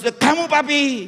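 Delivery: high, drawn-out wifely plea; slow, high-pitched imitation of a wife; worried wife's question. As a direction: imitate a wife crying out in concern. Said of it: "Terus istri saya tanya".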